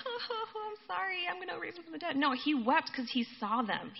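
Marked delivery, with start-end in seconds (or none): 0.1-2.1 high, soft mock-weepy whimper; fake crying. Imagined Jesus feigns sorrow before raising the dead, fake crying. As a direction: pretend to sob, voice pitched way up. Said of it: "so Jesus wasn't like".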